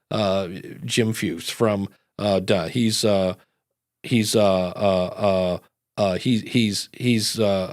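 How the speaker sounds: low droning host intro full of uhs; monotone bio reading. Acted. Host reading a guest's LinkedIn bio aloud, droning monotone. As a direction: stumble dully through a guest's bio. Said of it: "in a monotone voice".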